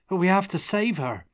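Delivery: put-on high, pleading boy's voice; protest, desperate to save her; protesting youth. A narrator's reading. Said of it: "he protested".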